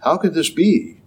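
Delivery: humble, open wondering question. Retold or imitated. Mary asking the angel in gentle humble wonder. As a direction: ask in gentle wonder, not doubt. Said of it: "there's a big difference in the tone there".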